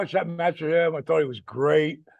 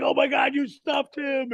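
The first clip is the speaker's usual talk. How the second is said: amazed; 10 semitones higher than usual. Retold or imitated. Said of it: "Big Show was like".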